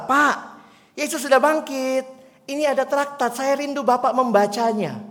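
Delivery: put-on high, loud little girl asking politely; a young girl pleading with an old man; eager child pressing a tract on a stranger; girl's plea.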